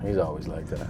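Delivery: low, quiet deadpan clerk; bored clerk. Retold or imitated. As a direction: shrug it off like a jaded clerk. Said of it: "the guy working at seven eleven was like".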